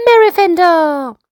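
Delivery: high, loud call across the pool; child shouting a name. Acted. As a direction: yell her name in outrage. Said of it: "Bevan shouts".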